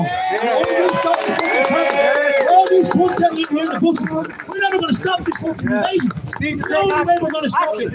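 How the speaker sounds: fired-up protest speech at a street rally. Real, not acted. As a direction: rouse the crowd from the stage.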